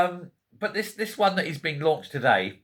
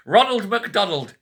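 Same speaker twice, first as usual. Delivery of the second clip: laughing it out, higher and slow; can't get the name out for laughing; repeating the economist's name in disbelieving laughter; 8 semitones higher, slower than usual. Real, not acted.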